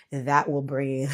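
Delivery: ordinary talk, nothing marked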